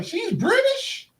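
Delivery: very high, drawn-out mock shock; squeaky-high incredulous question; astonished disbelief. Acts out her astonished first reaction.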